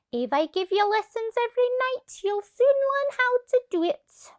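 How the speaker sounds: high, lively coaching voice; Annie the acrobat. A narrator's reading. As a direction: cheer her on as a peppy circus teacher. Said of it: "cried Annie the acrobat".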